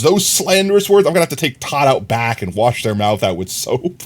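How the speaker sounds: usual speaking voice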